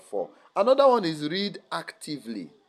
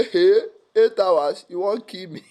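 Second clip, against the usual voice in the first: brain talking back; 6 semitones higher, 8 dB louder than usual. Retold or imitated. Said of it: "your brain will hear".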